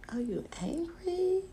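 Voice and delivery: high, whispery mimicked question; hushed mock-innocent needling; soft taunt. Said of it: "do the little soft".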